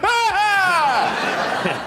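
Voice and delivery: a burst of laughing shock; startled cry. Retold or imitated. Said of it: "I was like".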